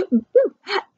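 high and loud gotcha; playful boo. Acted. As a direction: pop out and spook them. Character jumps out.

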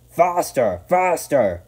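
high, loud urging cry; urgent grumbling shout. A narrator's reading. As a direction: shout it as trucks egging each other on. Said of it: "they grumbled".

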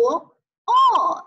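high-starting 哦 that falls; fourth tone. Shown as a demonstration. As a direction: drop 哦 firmly downward. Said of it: "四声是往下降的啊".

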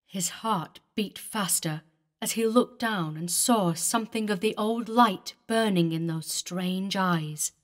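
usual speaking voice

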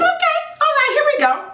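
squeaky, psyched-up "okay, here we go"; a playful high pep talk to herself; high mock pep talk. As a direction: pump yourself up in a high chirpy voice. Retelling her own decision to try.

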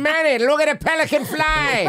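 high put-on gangster voice; Scarface quote voice. Friend quoting Scarface to Manny, high put-on voice.

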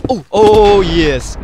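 loud victory cheer; loud joyful shout of victory; triumphant shout. Real, not acted. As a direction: cheer the kill. Winning the fight.

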